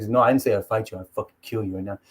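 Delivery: low, soft, head-down threat; John Jones. Retold or imitated. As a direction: imitate a fighter threatening calmly and quietly. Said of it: "He's, like, hanging his head down".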